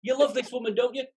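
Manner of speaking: Donkey egging his friend on, eager. Acted. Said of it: "I'll have a bash at Donkey".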